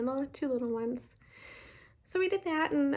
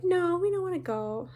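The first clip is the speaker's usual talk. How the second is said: higher, louder mock protest from the kids; a hint of reluctant kids, pitched up; kids' refusal; 8 semitones higher, 5 dB louder than usual. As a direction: lightly imitate children refusing an outing. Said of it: "And they're like".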